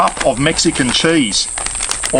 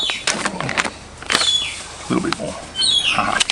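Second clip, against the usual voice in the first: whispered aside; 5 semitones higher, 8 dB quieter, much slower than usual. Real, not acted. Quiet aside while measuring.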